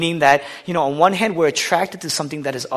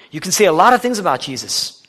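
louder, higher preacher's emphasis on lot; emphatic repeat; 4 semitones higher, 7 dB louder than usual. Shown as a demonstration. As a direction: drive the repeated line home.

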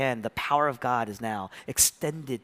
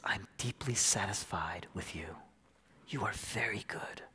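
soft, low whisper of deep approval; hushed, fatherly approval; tender whisper; 4 semitones lower, 8 dB quieter than usual. Acted. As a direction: whisper it low and intimately, as to a beloved son. God tenderly approving his son.

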